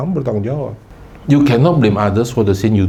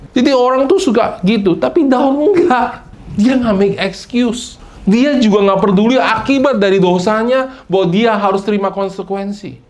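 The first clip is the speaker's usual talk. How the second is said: emphatic preaching; 9 semitones higher than usual. Real, not acted.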